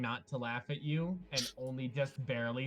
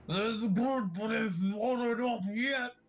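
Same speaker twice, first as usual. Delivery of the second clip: high, louder gurgling skeleton voice; burbling skeleton; 9 semitones higher, 4 dB louder than usual. Acted. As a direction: gargle the words through acid. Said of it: "he just kind of burbles through the acid".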